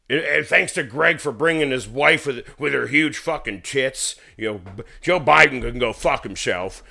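loud slurred toast voice; drunk best man speech. Retold or imitated.

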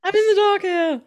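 high, loud theatrical copy; playful imitation, high and loud; mock dramatic echo. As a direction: echo the line back hammed up. Echoing friend's dramatic line.

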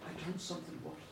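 low and soft, drawn out; grave confession. Acted. Monk telling the king of a worse sin, low and soft. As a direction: confess darkly. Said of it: "the monk looked and went".

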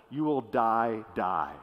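"die, die" with the second die sunk low; doubled word emphasis. Shown as a demonstration. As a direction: let the repeated die fall low and final. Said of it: "this is actual".

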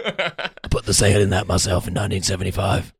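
lightly voiced low grumpy old man; gravelly voice. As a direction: growl it like a cranky old veteran. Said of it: "does the gravelly voice".

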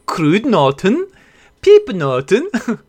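kruidnoten, piepnoten sounded out slowly with a rising stretch on piepnoten; mangled Dutch candy names; struggling Dutch pronunciation. Shown as a demonstration. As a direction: haltingly attempt the Dutch words kruidnoten and piepnoten. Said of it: "This is sounding like the Swedish chef".

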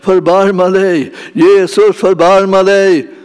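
loud, high, slow cry for mercy; desperate cry. Acted. Preacher voicing a beggar crying out to Jesus, loud and pleading. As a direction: call out to Jesus, begging him to stop. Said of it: "kommer någon och ropar".

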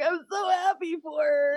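1 mock crying parents. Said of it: "They're like".

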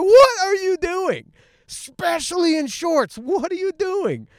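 high-pitched, hammed-up indignant yell; mock outrage. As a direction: wail it in exaggerated disbelief. Imagined crowd scolding the man.

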